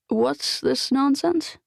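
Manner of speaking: a lightly voiced indignant old lady; scoffing patient. A narrator's reading. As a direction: scoff at the doctor's nonsense.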